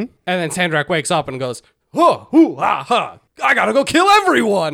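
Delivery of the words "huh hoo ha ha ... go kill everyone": higher, louder put-on villain with gasping laughs; waking villain. Sandrak waking up and threatening everyone, loud villain voice. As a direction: wake with a gasp, then menace everyone.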